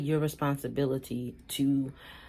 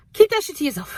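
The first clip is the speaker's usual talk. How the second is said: sassy snap; 10 semitones higher, 8 dB louder, faster than usual. Real, not acted. Dismissive punchline to viewer.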